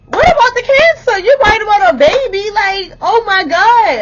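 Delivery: very high, loud yelling in disbelief; outraged shout. Retold or imitated. Woman reacting to ex hanging up over baby, outraged shout. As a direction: yell it at full pitch, incredulous. Acted outraged retort to hang-up.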